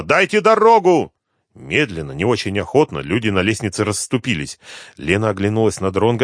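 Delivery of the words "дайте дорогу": loud, high, drawn-out shout; slow, forceful high cry. A narrator's reading. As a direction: shout over a crowd, stretching the words. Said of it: "кричала Суслова".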